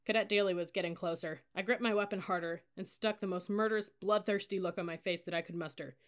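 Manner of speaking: plain reading voice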